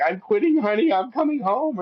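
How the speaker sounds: high, mock-whiny wife voice; hammed-up wife announcing she's quitting, pitched high; imitating his wife. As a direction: play the wife as if she's about to give up. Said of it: "my wife was gonna be like".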